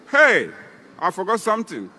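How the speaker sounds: usual speaking voice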